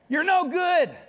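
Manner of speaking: high, loud comic scolding; mock scolding. Balaam scolding his donkey, high comic yell.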